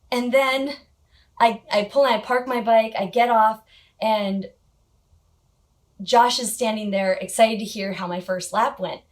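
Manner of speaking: normal talking voice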